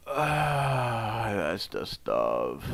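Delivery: low, slow, soft satisfied exhale; exhaling smoke. Real, not acted. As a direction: let it out slowly on a long breath. Puff break, exhaling smoke.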